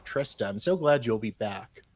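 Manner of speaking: usual speaking voice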